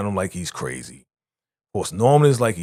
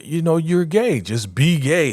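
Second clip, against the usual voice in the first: higher, louder, bouncy; mock cheerful push; 8 semitones higher, 4 dB louder than usual. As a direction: cheer him on, half-teasing. Kimmy voiced, pushy and upbeat.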